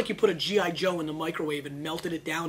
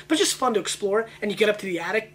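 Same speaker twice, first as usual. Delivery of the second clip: normal talking voice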